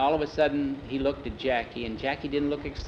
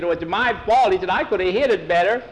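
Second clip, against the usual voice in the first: put-on guilty boy; 6 semitones higher, 7 dB louder than usual. Boy blames himself.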